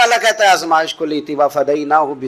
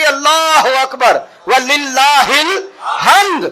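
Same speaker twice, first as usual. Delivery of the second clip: shouted takbir; 7 semitones higher, much slower than usual. Acted. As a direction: call it out full voice, drawn long.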